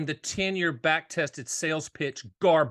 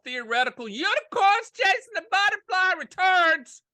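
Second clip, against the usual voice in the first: mocking shout; 13 semitones higher, 4 dB louder than usual. Real, not acted. Ranting about hypothetical returns.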